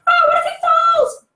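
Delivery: high, loud mock fret; mock parental worry. Parents in the audience worrying, a high loud mock fret. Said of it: "you're all thinking".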